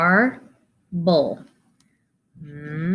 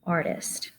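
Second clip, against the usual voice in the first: whispered word; 8 dB quieter than usual. Shown as a demonstration. Teacher whispers word after syllables.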